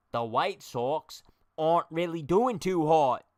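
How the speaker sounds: a put-on British accent, drawn out and hammed-up. Shown as a demonstration. Streamer testing a British accent on viewers, slow and hammed-up. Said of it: "now it's British".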